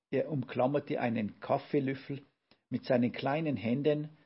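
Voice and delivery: ordinary delivery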